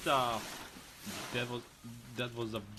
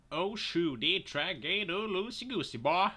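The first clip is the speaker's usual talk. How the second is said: higher-pitched, exaggerated silly character voice; playful mock accent, pitched up; put-on comic voice; 7 semitones higher, 3 dB louder than usual. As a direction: put on a high, goofy voice. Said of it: "I don't know why I'm talking like that".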